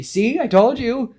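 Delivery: loud, high-pitched acted outburst; agitated exclamation. Gwen rushes, exclaims to Aunt May.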